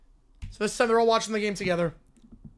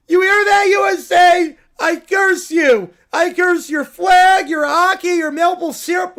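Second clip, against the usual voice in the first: much louder, higher bratty yelling; furious cursing shout; 5 semitones higher, 7 dB louder than usual. Acted. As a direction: spit each curse at the crowd. Caillou curses USA again.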